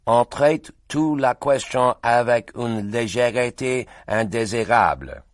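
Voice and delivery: a light French rendering, slow; French translation. Acted. Translator repeating the protest in French, slow.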